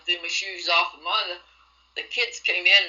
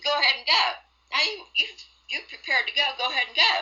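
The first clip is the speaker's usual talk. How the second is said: stepmother imitated far higher in pitch; mocking stepmother; 8 semitones higher, faster than usual. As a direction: mimic her coaxing, pushy tone, pitched high. Stepmother urging her to go.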